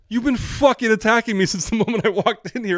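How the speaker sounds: high, loud, exasperated rant; indignant outburst. Retold or imitated. Applicant's unspoken answer to being told to smile, indignant. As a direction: let the held-in anger burst out. Outburst he held in his head.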